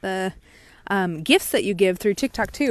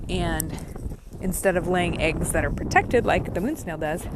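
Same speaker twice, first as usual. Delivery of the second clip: ordinary talk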